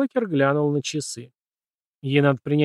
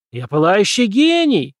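loud objection; 7 semitones higher, 6 dB louder, slower than usual. A narrator's reading. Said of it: "возразил Пембертон".

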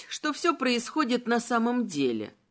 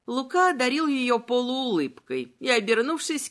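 plain reading voice

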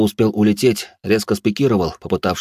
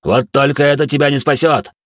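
rising, louder snarl back; growling bugbear; 7 semitones higher, 4 dB louder than usual. A narrator's reading. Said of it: "рыкнул я ему в ответ".

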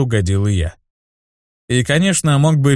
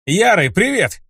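higher, calling out to a crowd; loud greeting; 7 semitones higher than usual. A narrator's reading. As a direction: call out boldly across the hall. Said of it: "громко сказал я". (громко, на весь зал)

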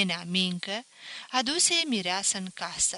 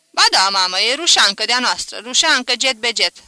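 loud, emphatic contradiction; eager insistence; 3 semitones higher, 11 dB louder than usual. A narrator's reading. Son reassuring doubting mother about bride, loudly insistent. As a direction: insist loudly that she is local.

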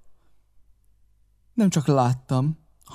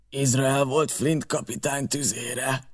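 hoarse; 4 dB quieter, slower than usual. A narrator's reading. Gray recalling the pirate gunner, hoarse and slow. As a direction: rasp it out slowly, hoarsely. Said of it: "szólt Gray reket hangon".

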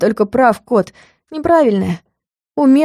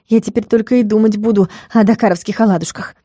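plain reading voice

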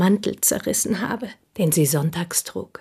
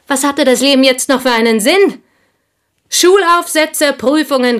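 rising, louder, bitter complaint; bitter outburst; 7 semitones higher, 7 dB louder than usual. Acted. Woman confessing to Monsieur Dange, bitter and louder. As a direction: spit out the dull list of school chores.

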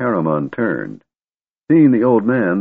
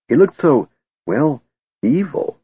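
ordinary delivery, nothing marked

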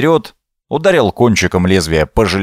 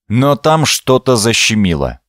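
ordinary delivery, nothing marked

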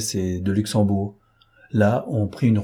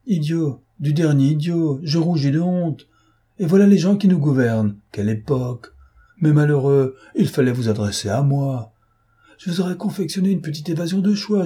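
mock despair at such stupidity; theatrical indignation, pitched up; indignant scorn; 7 semitones higher than usual. A narrator's reading. Lupin scoffing at bungled escape.

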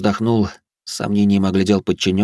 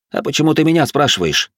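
higher-pitched bewildered question; genuinely baffled, pitch lifted; puzzled; 8 semitones higher than usual. A narrator's reading. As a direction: ask as if you can't see why. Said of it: "непонимающе поморщился Алис".

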